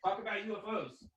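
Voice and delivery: high, put-on quiet order; telling Duran to fill airtime, goofy; mock command. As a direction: toss it over in a goofy voice. Voice hand-off to cohost.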